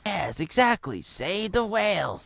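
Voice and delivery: high, drawn-out character voice; mock agreement. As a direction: stretch it out high in a silly put-on voice. Acted reply in film parody.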